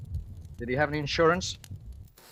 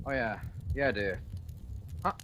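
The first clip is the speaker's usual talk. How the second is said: ordinary delivery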